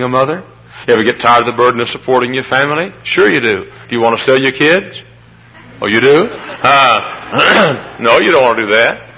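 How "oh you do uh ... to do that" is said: usual speaking voice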